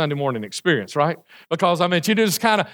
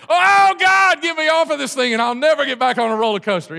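panicked prayer; 7 semitones higher than usual. Acted. Rider on the drop begging God, hammed-up panicked plea. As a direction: plead like you're falling. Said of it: "You are confessing all kinds of things to God".

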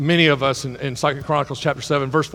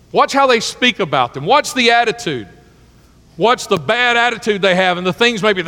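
higher and louder, insistent preaching; fervent exhortation; 5 semitones higher, 5 dB louder than usual. Acted. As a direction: press the warning home. Impassioned exhortation.

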